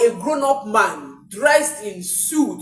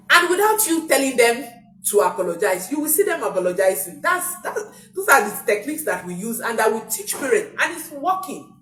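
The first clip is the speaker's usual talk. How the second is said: ordinary talk, nothing marked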